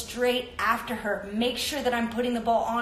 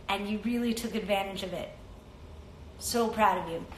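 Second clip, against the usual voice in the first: softer, lower, heartfelt congratulations; tender tribute; 4 semitones lower, 8 dB quieter than usual. Real, not acted. Host honouring a player who lost her mom, tenderly. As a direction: speak quietly and tenderly, from the heart.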